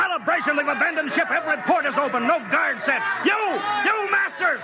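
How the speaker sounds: high, angry shout at the deserters; furious. Acted. Captain finds his crew gone, shouting in fury. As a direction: rage at the abandoned ship.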